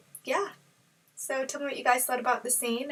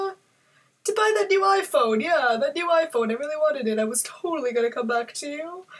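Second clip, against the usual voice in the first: lightly voiced sheepish Joker, rising and higher mid-excuse; Joker's excuses; 4 semitones higher, 5 dB louder than usual. Joker talks his way out with Harley, a hint of higher flustered voice. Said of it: "he probably starts going".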